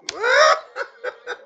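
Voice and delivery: high shrieking burst of laughter; loud laugh. Real, not acted. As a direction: howl with laughter. (قهقههٔ بلند و زیر)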